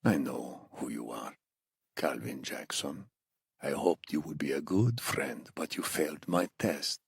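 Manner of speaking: put-on low, hushed accented menace; soft, chilling captor's reveal; Iranian interrogator, quietly menacing. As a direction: threaten the prisoner softly in an Iranian accent. Narrator voices the accented captor.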